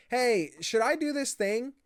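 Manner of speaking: high, slow imitation of an eager kid; child asking a parent. Nico asking the player for advice, childlike. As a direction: ask in a high little child's voice. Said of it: "parent-child relationship of".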